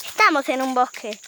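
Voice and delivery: usual speaking voice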